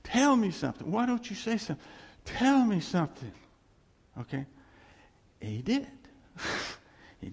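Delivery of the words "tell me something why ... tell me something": high, loud plea to God; retold screaming. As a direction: cry out in demanding desperation. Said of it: "And just screaming".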